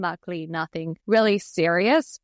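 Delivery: usual speaking voice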